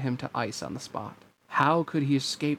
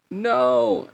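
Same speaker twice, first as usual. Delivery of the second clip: high, loud cry of protest; desperate cry; 9 semitones higher, 5 dB louder than usual. A narrator's reading. Girl crying out as the sword swings, desperate. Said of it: "As she cried out".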